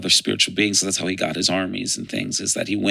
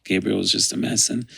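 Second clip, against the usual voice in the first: normal talking voice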